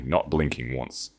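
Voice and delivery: plain reading voice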